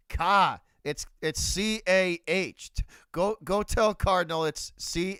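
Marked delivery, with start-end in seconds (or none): 0.1-0.6 loud, emphatic Boston car with no R; Boston accent. Shown as a demonstration. Streamer showing chat the word car, Boston accent. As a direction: drop the R, Boston style. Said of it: "with the Boston accent".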